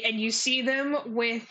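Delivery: usual speaking voice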